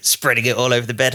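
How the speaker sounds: ordinary delivery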